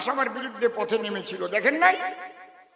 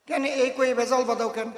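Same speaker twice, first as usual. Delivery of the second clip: normal talking voice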